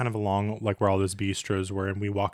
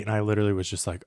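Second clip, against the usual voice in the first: ordinary talk, nothing marked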